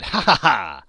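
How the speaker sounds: loud mocking laugh; harsh cackle. A narrator's reading. Charwoman cackling over the spoil, loud. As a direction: cackle in triumph.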